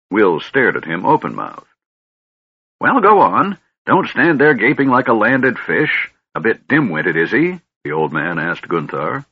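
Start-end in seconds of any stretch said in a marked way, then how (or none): 2.8-7.6 put-on high, impatient old man scolding; bossy old wizard. Fizban scolding servant.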